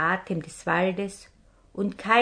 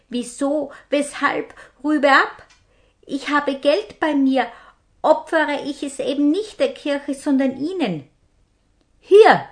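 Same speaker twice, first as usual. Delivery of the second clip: higher, louder hurried pleading; pleading captive; 7 semitones higher, 7 dB louder than usual. Acted. Brother offers his money to the captain, higher and pleading. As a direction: beg and bargain with the robber.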